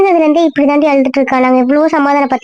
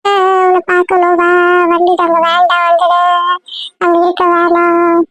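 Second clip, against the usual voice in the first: put-on crying child; 3 semitones higher than usual. Child telling her he wants to see her, crying. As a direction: whine through tears, dragging each word out. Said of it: "அழாம சொல்லு".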